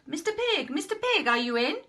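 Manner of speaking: high, loud call through the door; wolf calling to the third pig. Acted. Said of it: "I called".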